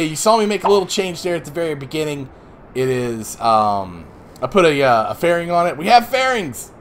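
ordinary delivery

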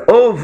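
mock puffing uf sounds; panting camel. Camel panting at the rider, loud puffing. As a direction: puff it out like a tired camel.

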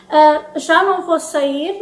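ordinary talk, nothing marked

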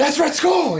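high loud shout of recognition; retelling the theater moment, a high excited yell. Retold or imitated. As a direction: yell the name out in delight. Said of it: "I was like".

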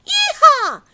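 high, loud cry; loud squeal. A narrator's reading. Javelinas crying out at the cookie, loud high squeal. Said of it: "gritaron las jabalinas".

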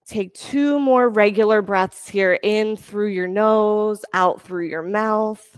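calm, steady instructor cues; yoga class cueing. Acted. Yoga teacher guiding students through poses, calm cueing. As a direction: lead the class through each pose gently.

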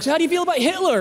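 high, eager blurted question; blunt plunge. Retold or imitated. Comic plunging into awkward talk with his seatmate, high eager blurt. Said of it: "just get awkward, let's do it".

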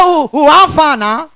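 comic stammerer blurting his way to a word; hooting false starts, then the word breaks free; stammering. Acted. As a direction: act out a chronic stammer. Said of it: "The first stammerer".

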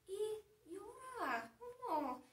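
high, soft, drawn-out mock sob; softly hammed-up weeping; mock crying. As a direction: whimper it slowly in pretend tears. Acting out the mouse's crying.

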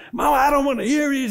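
high, loud and fast retort; mock angry yell. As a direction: throw it back at her mother, fast and high. Mother yells at grandmother.

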